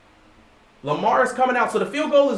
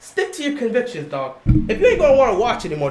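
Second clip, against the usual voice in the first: usual speaking voice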